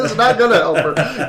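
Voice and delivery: loud, high laughing reply. Real, not acted. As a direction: laugh through the words. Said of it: "You're so excited".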